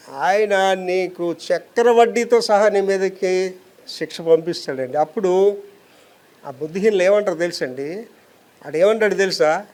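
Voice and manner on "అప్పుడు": ordinary talk, nothing marked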